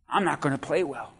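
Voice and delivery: quiet, low stubborn mumble; sulky churchgoer. Retold or imitated. Churchgoer refuses to worship, low sulky mumble. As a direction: mutter it like a pouting child. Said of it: "and you're like".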